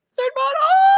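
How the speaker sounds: very high, loud hammed-up cry; mock childhood wail. Recalling crying as a child, with a hammed-up high wail. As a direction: wail the name like a heartbroken kid. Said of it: "being in my room alone crying".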